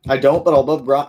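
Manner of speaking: ordinary delivery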